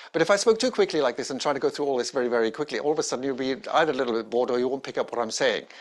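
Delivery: rushed, very fast demo of bad pace; too fast on purpose. Shown as a demonstration. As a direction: race through the words to show the fault. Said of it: "That's too fast".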